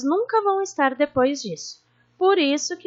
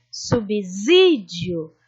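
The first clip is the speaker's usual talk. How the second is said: subsídio slowly, with a long sí; lengthened stressed syllable; 5 semitones lower, much slower than usual. Shown as a demonstration. Teacher showing pupils the stronger syllable, lingering on sí in subsídio. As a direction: stretch the sí in subsídio. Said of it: "a sílaba mais forte a gente se demora".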